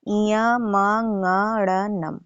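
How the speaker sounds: इयमंगड़नम very slow, each syllable separate; correct pronunciation. Shown as a demonstration. Teacher repeats इयमंगड़नम slowly for students. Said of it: "सही उच्चारण है".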